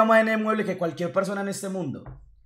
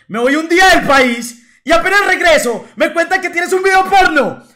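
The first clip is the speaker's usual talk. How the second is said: much higher, louder mock tirade; angry friend; 11 semitones higher, 11 dB louder than usual. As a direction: imitate Carlos scolding in outrage. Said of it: "se enojó hasta la chimba".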